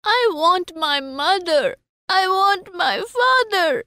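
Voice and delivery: put-on high, slow, drawn-out child's wail; sobbing child, acted. A narrator's reading. Child refuses all gifts, high slow acted sobbing. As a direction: draw it out high as a mock sob. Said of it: "He kept sobbing".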